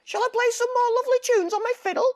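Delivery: very high, loud, fast cat voice; purr-squeak it like a chirpy cat. A narrator's reading. The fiddling cat sweetly pestering the wolf. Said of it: "the cat says".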